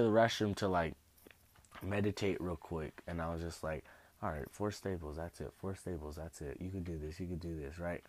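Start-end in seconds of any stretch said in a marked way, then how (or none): 4.2-7.8 low, soft self-pep-talk; hyping self up. Retold or imitated. Retelling a pep talk before getting staples, hushed self-encouragement. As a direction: psych yourself up under your breath. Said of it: "Hyping myself up".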